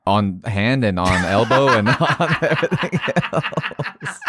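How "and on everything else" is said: words broken by laughter; laughing. Real, not acted. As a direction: laugh through the line. Laughing through joke.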